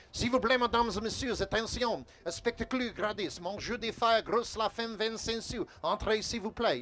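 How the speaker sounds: French-Canadian sideshow barker calling in French. Shown as a demonstration. Barker working a French province crowd, calling out in French. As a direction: invite the crowd in like a Quebec carny. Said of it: "here's how it sounds in Canada".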